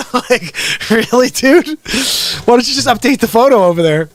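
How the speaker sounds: high-pitched, incredulous laughing; laughing tease. Real, not acted. Host ribbing someone over an old photo, laughing incredulously. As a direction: crack up through the words.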